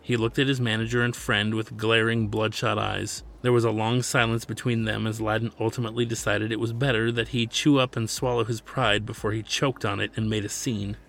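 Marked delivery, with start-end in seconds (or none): none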